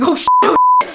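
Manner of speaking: high, loud panicked squeak; panicked yelp. Retold or imitated. Billy panicking as the Cullens announce return, high yelp. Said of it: "Billy's like".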